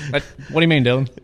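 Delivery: lightly put-on East Tennessee accent, played straight. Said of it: "you're speaking in an accent".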